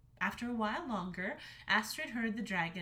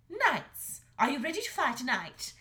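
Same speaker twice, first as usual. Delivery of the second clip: higher, louder taunting mother; mocking dragon mother; 5 semitones higher, 7 dB louder than usual. A narrator's reading. Mother dragon taunting her son, loud and high. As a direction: taunt him like a drill sergeant.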